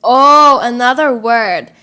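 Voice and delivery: ordinary delivery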